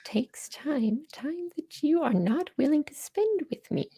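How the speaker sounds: put-on high, whispery old hag. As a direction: croak it out like a witch. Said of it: "not the hag voice".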